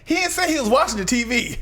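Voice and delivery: worked-up radio host retelling; Steve Harvey. Retold or imitated. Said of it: "Steve Harvey was like".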